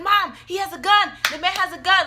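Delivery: high, loud panicked reenactment; retold scream. Running to warn mom at the car, reenacted screaming. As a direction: yell the warning, acting out the panic. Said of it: "Like, we're screaming at everyone in the car".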